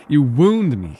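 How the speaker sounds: a hammed-up protest of injury; mock wounded. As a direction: clutch your chest in jest. Mock hurt at listener's tease.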